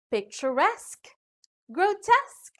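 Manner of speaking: "picturesque, grotesque" landing on RESQUE and TESQUE; last-syllable stress. Shown as a demonstration. Teacher giving examples of ending esque, "picturesque, grotesque" stressed at the end. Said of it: "usually carry stress on the last syllable".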